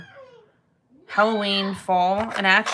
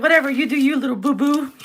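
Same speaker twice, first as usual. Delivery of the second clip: higher, louder teasing brush-off with a laugh; mocking dismissal; 6 semitones higher, 5 dB louder, faster than usual. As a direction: tease him like a baby, laughing it off. Mocking dismissal of her son.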